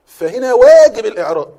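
loud, slow and forceful; emphatic declaration. Real, not acted. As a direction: hammer the point home to the class. Teacher driving home the rule. (بصوت عالٍ وحاسم)

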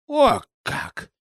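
put-on high, startled old woman's exclamation; a crone caught off guard; taken aback old crone. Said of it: "опешила старушка".

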